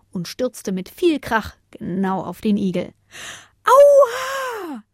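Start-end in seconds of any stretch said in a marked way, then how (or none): 3.6-4.8 high, loud "ow" howl; piercing, drawn-out yelp; wailing cry of pain. A narrator's reading. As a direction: shriek it out in pain. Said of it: "Ein wehklagender Schrei durchbrach die Nacht".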